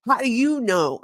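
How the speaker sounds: rough Southern accent imitation. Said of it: "Cardone's got a little bit of that Southern accent".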